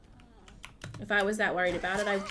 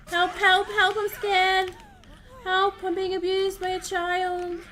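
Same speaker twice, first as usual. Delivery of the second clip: loud, high hammed-up cries for help; mock panic; 10 semitones higher, 9 dB louder, slower than usual. Streamer hunted by a teammate, mock screaming for help. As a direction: ham up the terror, yelling.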